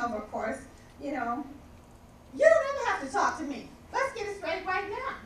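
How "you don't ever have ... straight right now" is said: fast, higher, louder telling-off; heated retort. Retold or imitated. Confronting the coworker who snubbed her, heated and fast. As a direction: snap at her to set it straight.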